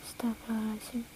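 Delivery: breathless whispered astagfirullah; whispered prayer. Real, not acted. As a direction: gasp it under your breath. Shocked aside to viewers. (bisikan istighfar kaget)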